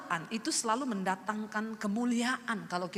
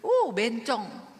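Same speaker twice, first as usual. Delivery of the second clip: drawn-out mock jeer, only mildly raised; mocking yell; 3 semitones lower, 3 dB louder, much slower than usual. Youth heckling a friend off to church, mock jeering yell. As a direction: stretch it out as a retold taunt. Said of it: "saya teriakin".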